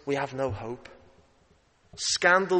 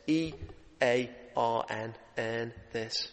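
E A R N spelled slowly, softer, then earn this; 5 semitones lower, 8 dB quieter, slower than usual. Shown as a demonstration. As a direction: spell it out letter by letter, slowly and quietly. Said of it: "just in case you didn't understand it".

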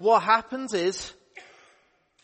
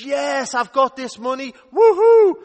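high, loud cheer of joy; excited whoop; 7 semitones higher, 12 dB louder than usual. Acted. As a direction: whoop like you just got rich. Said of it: "And he thinks".

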